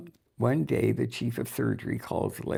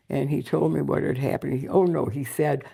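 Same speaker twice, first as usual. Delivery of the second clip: normal talking voice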